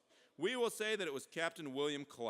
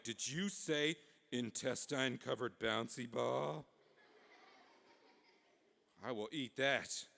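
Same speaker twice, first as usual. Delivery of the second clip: slow, drawn-out talking dog; 3 semitones lower, slower than usual. Acted. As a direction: drawl it like a food-struck dog. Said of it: "The dog came over and was like".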